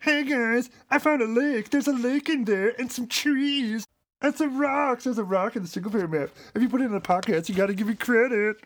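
high, whiny nerd; squeaky, eager nerd voice. Retold or imitated. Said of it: "put on your biggest nerd voice".

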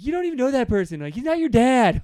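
God mimicked as a whiny teen, high and loud; exasperated high-pitched mock yell; whiny teenager. God scolding Israel over strange gods, in a whiny teenager voice. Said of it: "non-whiny teenager voice".

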